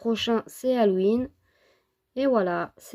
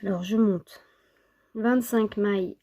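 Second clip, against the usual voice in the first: ordinary talk, nothing marked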